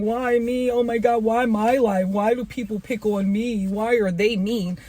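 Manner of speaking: mock wail of someone feeling sorry for themselves; whiny self-pity. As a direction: play the victim, lightly whining. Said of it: "I'm not saying".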